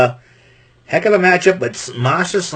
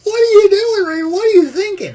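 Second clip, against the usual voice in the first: mock disbelief; 5 dB louder than usual. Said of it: "I'm like".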